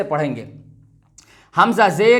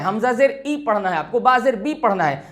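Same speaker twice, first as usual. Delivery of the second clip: ordinary talk, nothing marked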